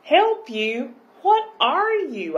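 high, loud disbelief; astonished question. Acted. Girl questioning the creature in the shell, astonished. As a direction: sweep the pitch right up in amazement.

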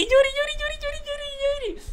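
nombre repetido en falsete, apagándose; coreo agudísimo. Real, not acted. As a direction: chilla su nombre como un pajarillo emocionado. Corea el nombre del amigo. (vocecita muy aguda de emoción)

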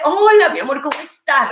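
high, effusive hello to a friend; mock-gushing social voice; gushing greeting. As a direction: greet a new mother with exaggerated affection. Mother greets friend who gave birth.

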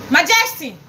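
normal talking voice

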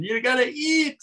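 lightly voiced, high insistent mother. Mother telling her son to eat, high mock insistence.

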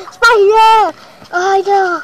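loud high yelp; screaming at a spider. Real, not acted. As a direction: shriek in fright. Spider attacks suddenly.

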